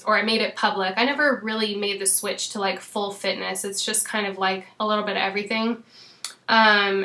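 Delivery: ordinary talk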